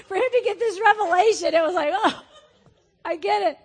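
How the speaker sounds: high, loud, laughing; laughing retelling. Real, not acted. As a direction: laugh through the realisation.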